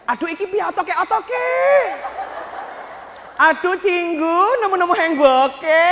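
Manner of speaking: high, slow wannabe Korean with a Javanese tongue; fake Korean accent. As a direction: mangle Korean like a wannabe K-drama fan. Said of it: "lidahnya lotek". (logat Korea dibuat-buat bercampur Jawa)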